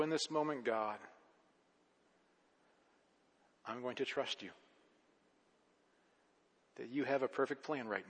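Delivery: spoken prayer. Shown as a demonstration. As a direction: pray it quietly, as if alone with God. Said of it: "modelling a prayer".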